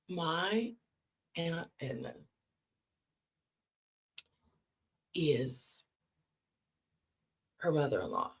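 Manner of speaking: low, with very long suspenseful pauses; dramatic reveal. Real, not acted. Teller revealing the family link to listeners, dramatic pauses. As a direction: drop the voice and stretch out the reveal.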